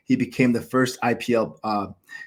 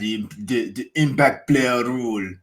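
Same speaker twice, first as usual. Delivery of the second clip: put-on evil villain accent. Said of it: "like, an evil villain accent".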